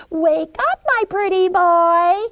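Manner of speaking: high, loud witchy croon; gleeful witch. Acted. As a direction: call out to your prey. Witch wakes Hansel, luring him.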